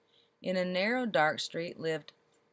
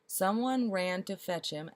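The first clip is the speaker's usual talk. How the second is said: usual speaking voice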